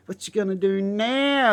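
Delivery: high, slow gloating taunt; taunting bird voice. Retold or imitated. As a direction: gloat like the bird has you trapped.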